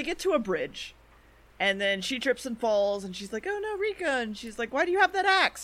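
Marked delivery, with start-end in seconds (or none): 3.5-4.2 high-pitched retold "oh no"; mock dismay. Acted dismay in retold fall. 4.7-5.6 high-pitched, louder retold question; mock alarm. Acted alarmed question in story.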